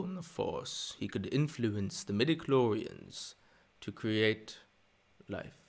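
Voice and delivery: slow, deliberate villain voice; sinister old storyteller. Acted. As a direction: tell a dark legend with long pauses. Recites Sith tale as Palpatine.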